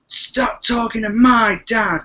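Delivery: put-on high, drawn-out furious kid yell; mock scream. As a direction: imitate a girl screaming, slow and loud. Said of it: "she screams".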